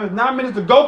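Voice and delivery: ordinary talk, nothing marked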